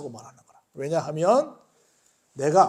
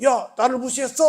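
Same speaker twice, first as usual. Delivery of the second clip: raised, louder mock challenge; offended confrontation; 6 semitones higher, 5 dB louder than usual. Preacher voices the slighted crowd confronting the leaders, mock offended challenge. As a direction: act out taking offence, higher and louder.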